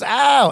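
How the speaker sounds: high mock cry of pain; mock yelp. Retelling Larry getting his nose pulled, mock yelp. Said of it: "Bucky pulls Larry's nose".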